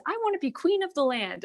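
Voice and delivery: high, insistent wife voice; the greedy wife insisting to her husband, high; demanding wife. Retold or imitated. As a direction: pitch up and push the demand.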